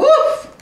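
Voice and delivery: high, loud burst of a woof; excited dog woof. Retold or imitated. Woman imitates her old dog spotting a rabbit, excited woof. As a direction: bark it out high and laughing. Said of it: "He's like".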